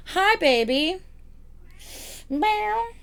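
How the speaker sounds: high cooing voice to a pet. Real, not acted. Greeting the cat in a high cooing voice. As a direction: coo it to the cat.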